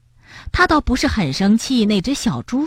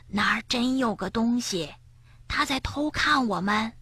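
soft urgent hushed no; quietly; 2 semitones higher, 9 dB quieter than usual. Acted. Frog insisting quietly to his friend, hushed. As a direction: hush the denial. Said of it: "跳跳小声说".

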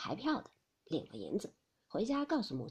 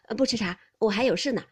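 put-on louder young man brushing it off over his shoulder; hurried refusal; 7 dB louder, faster than usual. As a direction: decline while walking away. Jia Yun declines leaving. (边走边推辞)

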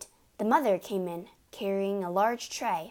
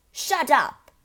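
angry snap; 7 semitones higher, 9 dB louder than usual. Acted. Father cuts Matilda off.

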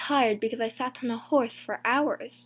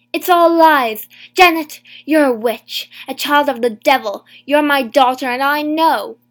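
furious shout; 3 semitones higher, 13 dB louder than usual. A narrator's reading. Mother accusing her daughter before the judge, furious shout. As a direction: scream the curse at her daughter. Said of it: "my mother shouted".

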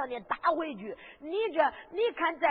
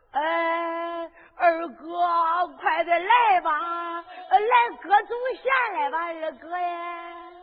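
louder drawn-out call to brother far off; 5 dB louder, much slower than usual. Acted. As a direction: shout across the river, slow and long. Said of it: "王能掂着人头就喊了". (大声呼喊二哥)

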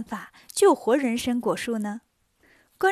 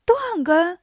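higher-pitched startled echo; astonished question; 5 semitones higher, 4 dB louder than usual. A narrator's reading. Three elders to Monkey on hearing the root is severed, astonished. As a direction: repeat it back in surprise. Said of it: "三老吃惊地问".

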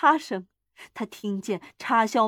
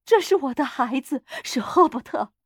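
higher, louder, pleading cry; tearful cry; 4 semitones higher, 4 dB louder than usual. A narrator's reading. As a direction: cry it out like a desperate mother. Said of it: "他哭喊着说". (带着哭腔喊)

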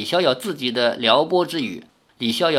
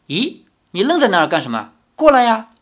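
brisk question, then higher, louder call; puzzled beckoning; 6 semitones higher, 3 dB louder, faster than usual. A narrator's reading. Girl calling Li over in the dark, puzzled and urging. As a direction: urge him over with mild impatience.

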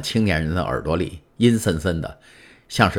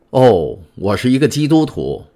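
raised, higher, louder protest; 7 semitones higher, 5 dB louder than usual. A narrator's reading. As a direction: call out earnestly so he won't leave. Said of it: "唐泰斯大声说". (高声急切地表白)

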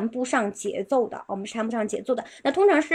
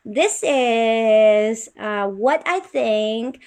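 slow, stretched "This is, uh, what I think"; teacher demo of a slow, thoughtful rhythm; drawn-out syllables; 5 dB louder, much slower than usual. Shown as a demonstration. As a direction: stretch each syllable to leave room for thought. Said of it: "就类似这种，我们把这个就是音节拉长".

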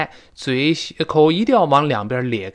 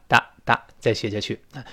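normal talking voice

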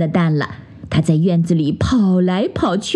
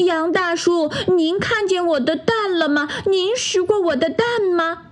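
high, worried little duck voice; a fretful mother duck searching; anxious duck mother; 11 semitones higher than usual. Acted. Duck mother asks the goat. (着急找蛋的鸭妈妈，高声询问)

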